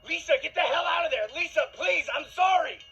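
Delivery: high, full-force panicked shout; desperate shouting. Acted. Boyfriend desperately trying to stop his girlfriend, frantic shouting. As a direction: scream a frantic plea to stop her. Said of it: "I began shouting".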